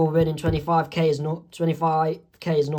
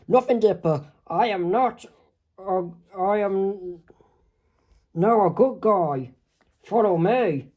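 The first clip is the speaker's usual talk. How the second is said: raised, odd put-on voice for the villain Bill; Bill's sly voice. Bill tells Dipper to follow him, in an odd put-on voice. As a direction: play the trickster luring him in.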